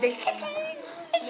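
very high, drawn-out squeal of a quote; meme echo. Retold or imitated. Echoes the chicken meme quote.

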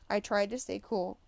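plain reading voice

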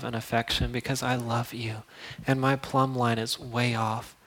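soft confessing prayer; quiet prayer. Acted. Believer confessing hatred to God, soft prayer. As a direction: pray it quietly, honestly.

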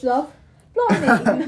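hammed-up British accent; mock British accent. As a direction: put on a playful British accent. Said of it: "in a British accent".

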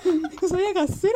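high laughing panic, hard to get out. Retold or imitated. Kids stuck with an unconscious godmother, laughing.

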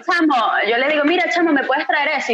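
playful Venezuelan speaking to Spanish classmates. Retold or imitated. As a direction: say "chamo" like a Venezuelan in Spain. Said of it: "yo digo".

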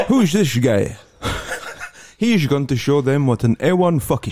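mock Dutch commentator pushing the line; Dutch porn narrator. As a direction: hype it up like a cheesy Dutch announcer. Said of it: "And he goes".

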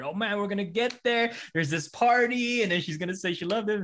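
hopeful excitement. Retold or imitated. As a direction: get giddy rooting for the couple. Retelling hopeful rooting for couple.

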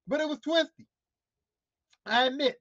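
ordinary talk

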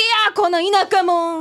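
put-on slow, heated kid's insult; angry retort. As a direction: fire the insult right back at him. Girl snaps back at bully.

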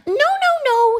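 very high, loud string of no's; emphatic correction. Acted. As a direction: cut them off in an exasperated squeal. Carrot corrects the others.

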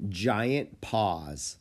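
ordinary delivery, nothing marked

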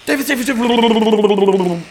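high, loud trilling babble; imitated chat flood. Streamer imitating a chat flooded with Davis, high trill. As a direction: let the name dissolve into a long trill. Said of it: "It just goes".

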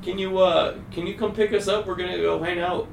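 high, bright fake-innocent hello; excited stoned greeting. Stoned teen answering grandma's call, high and overexcited. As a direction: sound way too excited to be normal. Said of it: "you sound really excited and, like, energized".

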